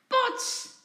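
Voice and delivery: high, loud exclaimed crash; sudden smash. A narrator's reading. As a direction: burst out like a pot shattering. Pot smashes on the floor.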